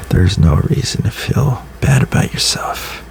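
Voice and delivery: gentle whispered reassurance; whispered pep talk. Real, not acted. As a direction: whisper it kindly, close to the mic.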